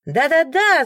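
crowd agreeing. A narrator's reading. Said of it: "загудела небольшая толпа".

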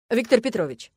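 the manager runs up shouting; excited manager. Acted. Loud call.

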